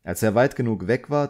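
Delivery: ordinary delivery, nothing marked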